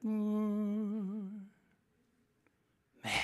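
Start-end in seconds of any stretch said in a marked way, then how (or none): 0.1-1.5 long steady monotone hum; held, softer "mm" as a dial tone; imitated dial tone. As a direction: imitate a phone line gone dead. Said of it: "I just heard".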